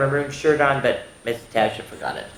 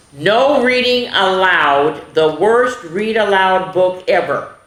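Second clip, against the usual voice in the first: dramatic title read; 6 semitones higher, 6 dB louder, slower than usual. Shown as a demonstration. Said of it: "is the name of it".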